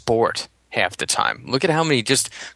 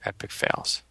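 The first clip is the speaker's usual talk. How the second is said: whispering; 8 dB quieter than usual. Acted. Whispered aside during podcast banter.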